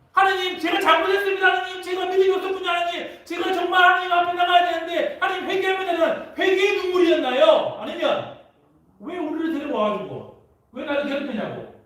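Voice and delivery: high, shouted lament to God; wailing prayer. Acted. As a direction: cry out to God as the Israelites. Acted crying prayer of Israelites. (하나님께 울부짖는 높은 외침)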